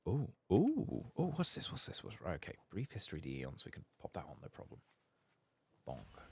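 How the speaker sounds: ordinary talk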